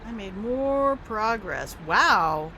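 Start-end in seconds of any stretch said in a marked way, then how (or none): 1.9-2.5 loud, impressed exclamation; amazed wow. Real, not acted. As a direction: gasp it out, genuinely impressed. Reacting to game achievement.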